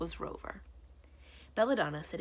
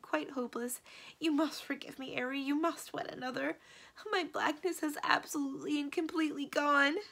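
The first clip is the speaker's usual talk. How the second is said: high, anguished lament; despairing cry; 9 semitones higher than usual. A narrator's reading. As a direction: wail it out, giving up on everything. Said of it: "she cried".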